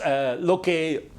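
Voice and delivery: ordinary talk, nothing marked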